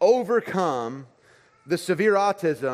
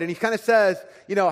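ordinary delivery

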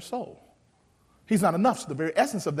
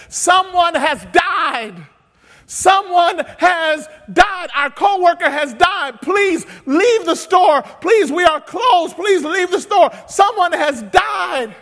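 slow, shouted, higher announcement; intercom plea; 13 semitones higher, 15 dB louder, much slower than usual. Retold or imitated. Workers announcing a death over the intercom, shouting slowly. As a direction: call it out slowly and loudly over the PA. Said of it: "we got on the intercom and we literally said".

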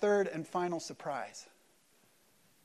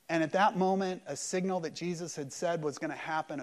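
ordinary talk

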